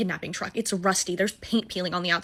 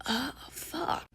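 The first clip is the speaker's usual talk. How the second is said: breathy, much softer letdown; disappointed; 5 semitones higher, 11 dB quieter than usual. Retold or imitated. She sees how short Teddy is, muttered dismay. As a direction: mutter it under your breath. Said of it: "I'm like".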